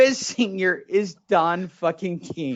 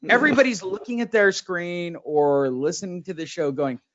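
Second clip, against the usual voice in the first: ordinary talk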